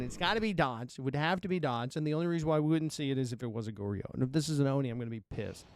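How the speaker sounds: normal talking voice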